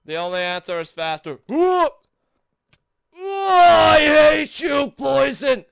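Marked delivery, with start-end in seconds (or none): 1.5-1.9 high, loud "whoop"; startled whoop. Real, not acted. Player rushing through the level, high whoop. Startled while going faster. 3.2-5.6 high, loud wail of hatred; furious yell. Real, not acted. Player cursing the poison, furious high yell.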